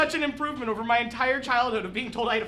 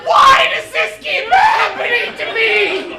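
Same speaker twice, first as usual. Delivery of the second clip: much higher, much louder crying yell; despairing wail; 9 semitones higher, 11 dB louder than usual. Acted. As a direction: howl in mock despair, half laughing. Killed again, despairing.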